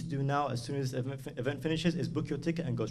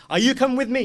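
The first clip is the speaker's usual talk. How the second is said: high, raised ringing call; louder and higher, summoning someone; acted urgent call; 11 semitones higher, 10 dB louder than usual. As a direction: call out the question to each follower. Imam's call repeated, acted.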